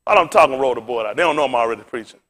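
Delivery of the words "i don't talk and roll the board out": usual speaking voice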